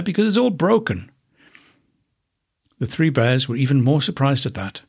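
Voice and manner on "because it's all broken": high little cub voice; high, dismayed little bear. Acted. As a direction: wail it like a small cub. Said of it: "said Little Bear".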